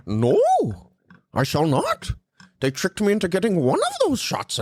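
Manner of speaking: solemnly explain, very proper; Jordan Peterson. Acted. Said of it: "Jordan Peterson kept trying to fucking steal my show".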